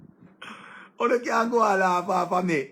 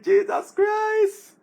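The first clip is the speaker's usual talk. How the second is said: ecstatic exclamation; 13 semitones higher, 5 dB louder, much slower than usual. Real, not acted. Excited reaction to a big word.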